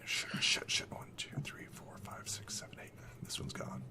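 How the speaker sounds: barely voiced whisper; hushed panic. Bandits whispering to each other as they count, hushed whisper. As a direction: whisper it under your breath.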